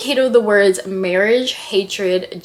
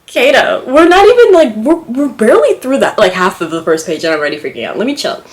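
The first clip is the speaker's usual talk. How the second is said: high, loud and fast; gush, freaking out with delight; giddy; 6 semitones higher, 8 dB louder than usual. Real, not acted. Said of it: "I'm getting so giddy".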